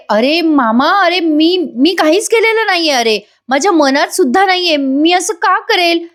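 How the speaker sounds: high-pitched mock protest of innocence; pleading denial. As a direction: plead with uncle, drawing out the last words. Ramya pleads her innocence.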